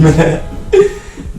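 high, loud burst of real laughter. Real, not acted. Laughs at his own joke.

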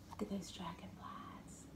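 soft unvoiced whisper. Real, not acted. Grandma whispering to Lucas about dragonflies. Pointing out picture to baby.

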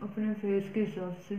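speaking with face mask on; wearing sheet face mask. Real, not acted. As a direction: hands smoothing mask while talking. Seen 0: Sheet mask on face throughout; mouth opening leaves lips mostly free.

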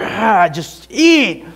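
high, drawn-out exasperated plea; exasperated parent begging a child; frustrated urging. Retold or imitated. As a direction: drag the words out high in frustration. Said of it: "I would get so frustrated".

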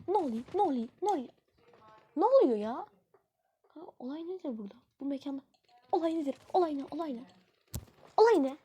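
high, loud, rapid-fire panicked questions; frantic questions. Real, not acted. A gamer yelling in panic as the enemies close in.

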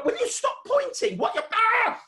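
high rant breaking into a yell; mock exasperation. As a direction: play flustered until you shriek. Mock exasperation at children pointing.

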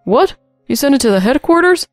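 high, loud disbelief; shocked gasp. A narrator's reading. Manager learning headquarters has the video, aghast. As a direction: exclaim in alarm, voice jumping up. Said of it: "the manager gasped".

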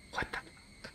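horrified whisper; whispered shock. Real, not acted. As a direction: breathe it out in disbelief.

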